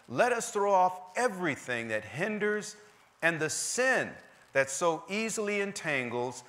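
high, slow, emphatic pulpit reading; scripture reading. Acted. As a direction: proclaim the verse to the whole church. Reads scripture to the congregation.

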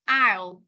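"I'll" as one syllable; contracted pronunciation. Shown as a demonstration. Teacher showing class how I'll is read, contracted. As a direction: say "I'll" as a single quick word. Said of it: "cái từ này nó đọc là".

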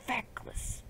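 soft whispered grumble; muttered insult. Real, not acted. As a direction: curse it under your breath. Muttered insult after outburst.

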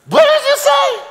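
very high, loud mock disbelief; incredulous outburst. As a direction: shriek it in comic disbelief. Widow reacts in disbelief.

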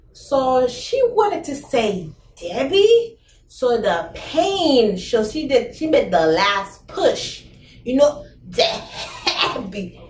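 strained, slow straining push; acting out labor. Retelling her mother's birth, straining and slow through the push. Mother pushing out baby, says name.

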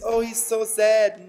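ordinary talk, nothing marked

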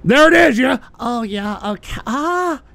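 lightly voiced high, effeminate caller parody; a hint of mocking the caller's voice. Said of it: "That is not at all what I sounded like".

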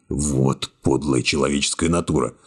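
ordinary delivery, nothing marked

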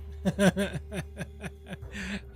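long staccato laugh; gloating laugh. Real, not acted. Player gloating over a downed enemy, gloating laugh. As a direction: laugh in triumph over a beaten foe.

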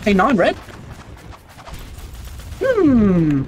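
normal talking voice

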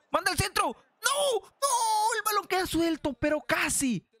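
very high-pitched frantic match cry; excited yelling. Real, not acted. Gamer calling a near miss to viewers, excited high yelling. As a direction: scream as if the goal is coming, then groan it away. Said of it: "Ya estábamos gritando el gol".

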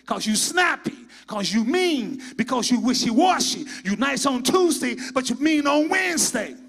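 loud, rising sermon rebuke; preaching at full force. Acted.

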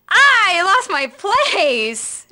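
very slow, exaggerated complaint; comic wail. Real, not acted. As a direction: stretch it into a mock whine. Whines after losing place in script.

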